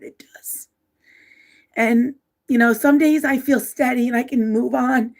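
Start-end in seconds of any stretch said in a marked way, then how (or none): 0.0-0.7 whisper. Real, not acted. Emotional grief, voice drops away.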